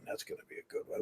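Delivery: whispered aside, no voice at all. Real, not acted. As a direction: breathe it out as a secret. Repeats the line, then whispers it.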